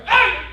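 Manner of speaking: high hammed-up shriek; loud comic scream; mock shriek. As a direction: squeal like a startled bride. Wife shrieks at touch.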